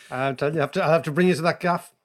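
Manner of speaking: normal talking voice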